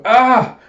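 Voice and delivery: short, high, loud yell; acted cry. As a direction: cry out in mock alarm. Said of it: "you hear a cry of".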